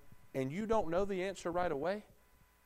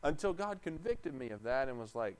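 ordinary talk

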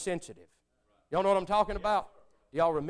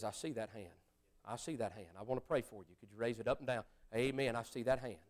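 soft, low, hushed altar call; hushed preacher; 8 semitones lower, 11 dB quieter than usual. Retold or imitated. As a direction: murmur it like a pastor scanning the room. Preacher softly spotting hands.

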